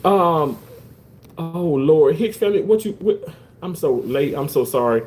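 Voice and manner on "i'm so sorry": ordinary talk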